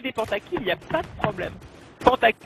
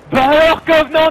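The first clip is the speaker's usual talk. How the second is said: loud taunt; 6 semitones higher, 12 dB louder than usual. Shown as a demonstration. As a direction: bellow the taunt really loud. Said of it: "faut faire ça bien fort".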